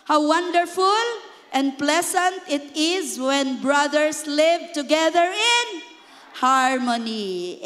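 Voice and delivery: loud, slow unison scripture reading; congregation reading aloud. Shown as a demonstration. Pastor leads church in reading Psalm aloud, loud and slow. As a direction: lead the flock through the verse together. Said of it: "okay, read this. Ready, go".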